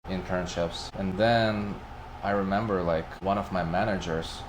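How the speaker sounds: ordinary delivery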